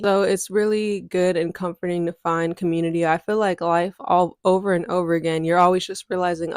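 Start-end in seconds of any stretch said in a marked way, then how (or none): none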